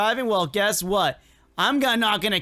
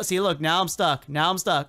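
normal talking voice

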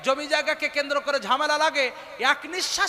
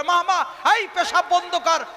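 imitated angry order, pitched higher; harsh-tempered scolding; 5 semitones higher, 4 dB louder than usual. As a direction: act out an indignant command to stop. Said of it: "মেজাজ কড়া করে". (কড়া মেজাজে ধমকের অভিনয়)

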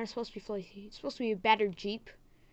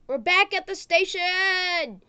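high, loud, stretched-out cheer; triumphant announcement; 7 semitones higher, 8 dB louder, slower than usual. Real, not acted. Rider back at the station, triumphant shout. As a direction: sing out the arrival slowly and loudly.

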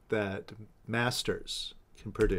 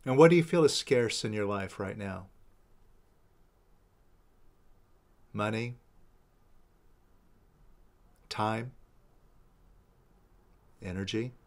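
soft, unhurried meditation guide with long pauses; guided meditation; 6 dB quieter than usual. Real, not acted. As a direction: guide them gently inward, leaving space between phrases. Leads a releasing meditation.

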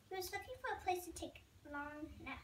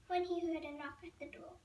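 plain reading voice

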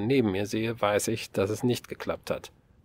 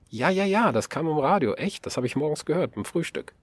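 hoch und laut, die Worte überschlagen sich; aufgeregt; 6 semitones higher than usual. A narrator's reading. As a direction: ganz aufgeregt, es fällt ihr plötzlich ein. Aufgeregt über Radiomeldung. (aufgeregt und deutlich höher)

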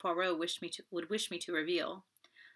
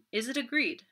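higher, pressing question; urging; 6 semitones higher, 4 dB louder than usual. A narrator's reading. Poirot presses Giraud to accept the wager, urging. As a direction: push for his agreement. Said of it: "urged Poirot".

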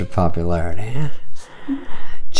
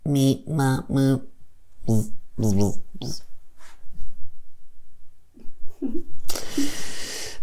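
soft beeping robot voice; 5 semitones higher, 6 dB quieter, much slower than usual. Acted. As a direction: beep like a little robot. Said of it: "replace journalists with robots".

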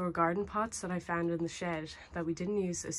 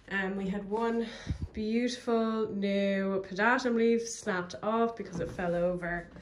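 ordinary talk

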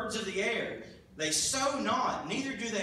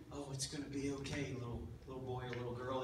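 much softer, lower, hushed comfort; soothing; 8 semitones lower, 10 dB quieter, faster than usual. Acted. God gently comforts a frightened little child in a soft low hush. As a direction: coo it like calming a small child.

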